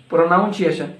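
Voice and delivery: "Pronunciation" as pro-NOUN-see-ay-shun; wrong PRONUNCIATION. Shown as a demonstration. Teacher quizzing learners on "Pronunciation", with NOUN inside. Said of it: "It's not pronunciation".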